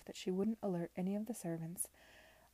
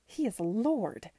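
loud, talking down to a fool; condescending; 3 semitones higher, 8 dB louder than usual. Acted. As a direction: spell it out as if to a dimwit. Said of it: "as though speaking to someone unforgivably dull-witted".

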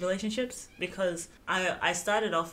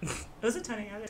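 ordinary talk

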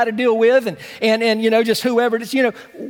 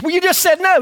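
preacher's retort; 7 semitones higher than usual. Retold or imitated. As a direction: shoot back at the boy. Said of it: "preacher retorts".

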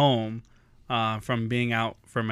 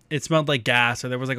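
ordinary talk, nothing marked

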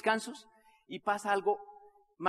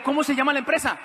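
loud, high, eager question; urgent demand; 6 semitones higher, 8 dB louder than usual. Retold or imitated. César demands company name from brother, urgent.

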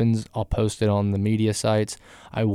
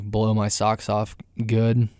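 usual speaking voice

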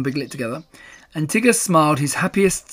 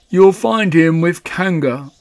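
slow, louder gloomy donkey; gloomy Eeyore; 2 semitones higher, 5 dB louder, slower than usual. A narrator's reading. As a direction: draw it out heavily as the gloomy donkey. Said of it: "was always gloomy".

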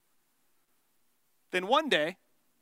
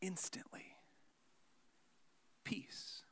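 fading to a whisper; whispered peace after the storm; hushed calm; 4 semitones lower, 21 dB quieter, much slower than usual. Shown as a demonstration. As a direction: let the voice die away into stillness. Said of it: "describing storm stopping".

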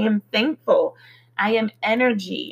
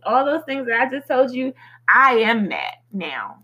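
ordinary talk

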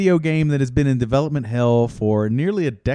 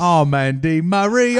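ordinary delivery

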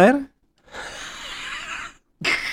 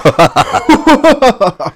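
evil laugh; 10 semitones higher, 3 dB louder than usual. Pretend. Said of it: "Es una gran risa".

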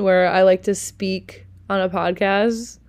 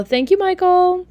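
name sung out much higher; excited shout out; 11 semitones higher, 3 dB louder than usual. Real, not acted. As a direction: call his name out happily, like a shout out. Thank-you shout out to Michael.

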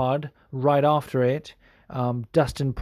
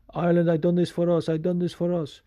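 higher, fast Irish-tinged shout-out to his homeland; imitating Conor McGregor; 4 semitones higher, faster than usual. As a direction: channel a triumphant fighter addressing Ireland. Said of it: "fight when he's like".

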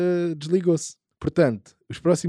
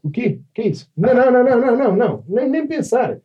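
much higher and louder, shocked; shocked shouting; 8 semitones higher, 4 dB louder than usual. Real, not acted. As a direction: yell no in disbelief. Shocked by something shown.

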